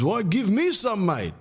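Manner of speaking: high-pitched put-on old man; hammed-up weary old father, pitched high; seventy-year-old dad. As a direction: plead tiredness in a cartoon dad voice. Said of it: "And he goes".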